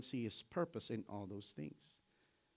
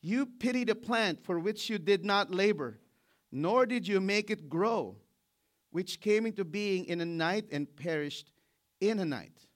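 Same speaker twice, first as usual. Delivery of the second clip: God's rebuke; 6 semitones higher, 9 dB louder than usual. Acted. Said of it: "And the Lord said".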